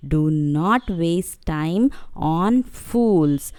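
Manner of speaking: very slow and soft, each word set apart; stressing the moral. Shown as a demonstration. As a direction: spell out the lesson gently to the kids. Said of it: "what did you learn from the story".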